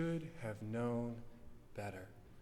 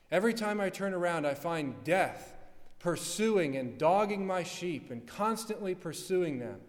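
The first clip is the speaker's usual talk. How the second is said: Christ's urgent challenge; 4 semitones higher, 7 dB louder than usual. Acted. Christ voiced asking about death.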